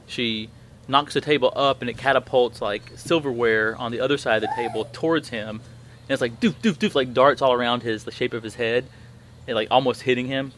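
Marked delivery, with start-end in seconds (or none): 6.4-6.9 imitated thuds. Silverware thudding around his head.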